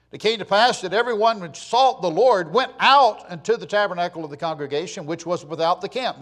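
declaimed Bible reading in strong, rising swells; preacher's scripture reading. Acted. Preacher reads God's command to Moses, scripture reading. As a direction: proclaim the verse from the pulpit.

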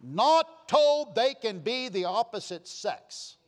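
loud, high, slowed preaching build; fiery preaching. Acted. Preacher rousing the congregation, loud and high. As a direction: thunder it from the pulpit.

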